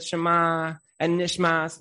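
ordinary delivery

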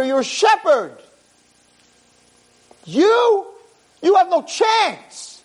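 high, scornful brothers' taunt; mocking scorn. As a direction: sneer down at the little boy. Brothers mock young David.

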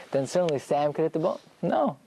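ordinary talk, nothing marked

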